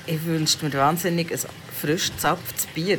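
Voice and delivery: ordinary talk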